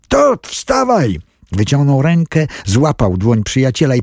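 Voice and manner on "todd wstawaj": high, slow shouted plea; urgent shout. A narrator's reading. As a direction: drag the words out in alarm. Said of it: "krzyknął Tom".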